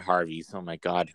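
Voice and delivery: ordinary talk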